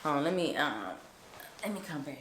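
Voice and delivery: usual speaking voice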